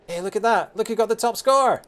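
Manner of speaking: high, loud exclamation over the results; excited onlooker. Acted. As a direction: call out the big news, voice jumping up. Voicing onlookers at exam results.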